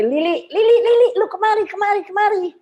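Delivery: high, loud call across the room. Retold or imitated. Calling a friend over for the photo, high and loud. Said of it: "saya nih bilang gini".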